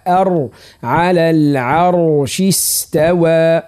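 slow measured verse with thick R; heavy ra. Shown as a demonstration. Teacher modelling the verse ending, ra heavy. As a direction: back the R in al-arsh, drawn out. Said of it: "صوت مفخم".

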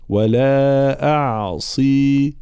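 أعصي with light hamza and ain, heavy ص, clear kasra; slow drawn-out أعصي; correct tajwid. Shown as a demonstration. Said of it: "ترقيق الهمزة والعين ثم تفخيم الصاد". (ترقيق الهمزة والعين وتفخيم الصاد)

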